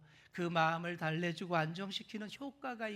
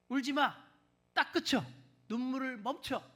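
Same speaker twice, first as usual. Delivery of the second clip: higher, louder, stern order to stop; harsh command; 6 semitones higher, 5 dB louder than usual. Shown as a demonstration. Preacher shows the wrong sense, a stern loud order. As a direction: bark it like a scolding. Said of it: "이 얘기일까요? 아닙니다".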